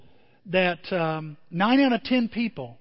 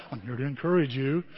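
mock solemn visitor; 6 semitones lower, 4 dB quieter than usual. Mock pious hospital visitor.

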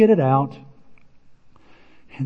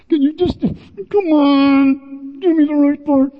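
much higher, louder, begging come on; exasperated plea; 10 semitones higher, 3 dB louder, slower than usual. Retold or imitated. Tim on the phone asking the office for the right part, high exasperated plea. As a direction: plead like you're at the end of your rope.

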